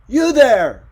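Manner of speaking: very loud, high shout; crying out. A narrator's reading. Ricardo yelling at the cameraman below, full shout. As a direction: yell it down into the alley. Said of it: "he cried".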